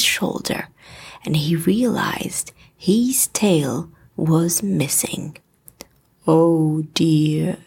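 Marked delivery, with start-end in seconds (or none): 6.3-7.6 low, gloomy moan as Eeyore; a low, drawn-out donkey moan. A narrator's reading. Eeyore moaning to himself over his lost tail. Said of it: "he moaned".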